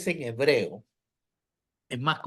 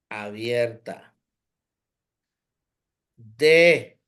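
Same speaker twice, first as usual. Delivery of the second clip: slow, spaced, one word at a time; slow syllable breakdown; much slower than usual. Shown as a demonstration. Teacher dividing the word into syllables for the students, slow and spaced. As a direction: break down "Adán" into syllables for the class, slowly. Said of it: "fíjese bien".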